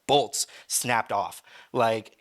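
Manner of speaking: normal talking voice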